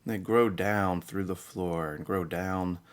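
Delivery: soft, unhurried meditation voice with long pauses; guided meditation. Acted. Guide telling listeners to breathe and relax, soft meditation voice. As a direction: guide them gently into calm, hushed and spacious.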